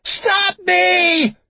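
piercingly high, loud, desperate scream. A narrator's reading. Captive with a knife begs to be stopped, screaming. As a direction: scream it out in terror. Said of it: "Then she screamed, so loudly".

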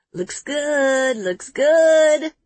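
upbeat approval. A narrator's reading. Photographer approving.

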